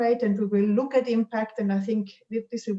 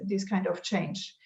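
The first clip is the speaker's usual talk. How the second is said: ordinary talk, nothing marked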